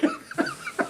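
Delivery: short high burst of laughter. Real, not acted. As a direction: laugh at the absurdity.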